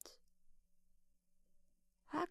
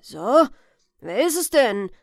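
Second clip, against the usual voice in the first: put-on old man's loud challenge; demanding question; 11 dB louder than usual. Old man asking who is at the door, loud demand. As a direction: call out sharply to the unseen caller.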